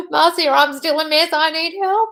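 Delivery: high, loud crying plea; tearful plea. Retold or imitated. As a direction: beg for help through tears. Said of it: "I was in floods of tears".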